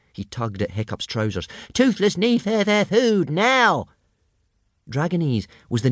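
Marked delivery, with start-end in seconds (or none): 1.7-3.9 small dragon whining high and stuttery; a little dragon whines for food. A narrator's reading. Small dragon Toothless whines to Hiccup for food, high and stuttery. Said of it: "He was whining to Hiccup in Dragonese".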